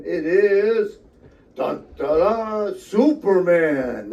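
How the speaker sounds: big, drawn-out showman's reveal; mock fanfare reveal. Co-host unveils the arm tattoo to listeners, mock fanfare. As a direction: announce it like a superhero entrance.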